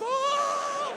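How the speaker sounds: high shrieking squeal; excited squeal. Retold or imitated. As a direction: let out a thrilled little shriek.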